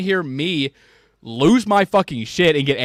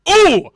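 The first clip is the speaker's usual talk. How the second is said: excited whoop; 9 dB louder than usual. Real, not acted. Exclaiming at fight fantasy.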